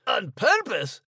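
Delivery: surprised echo. Acted. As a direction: echo "On purpose?" in disbelief.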